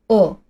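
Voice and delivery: "哦" cut short with natural, unpursed lips; short vowel demo. Shown as a demonstration. Teacher shows the mouth position's sound, short lax "哦". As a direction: model "哦" as a brief lax English vowel, unlike Mandarin 乌. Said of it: "所以它的发音是短促的".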